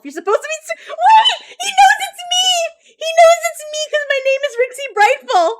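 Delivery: high, loud, laughing shout; excited shriek. Real, not acted. Streamer yelling in delight that the chatbot recognises her.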